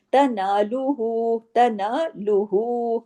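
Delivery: तनालुहु slowly with the final हु stretched; slow recitation. Shown as a demonstration. Teacher repeating the word for students, slow. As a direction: draw out the हु of तनालुहु. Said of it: "उसका भी हक अदा करना है".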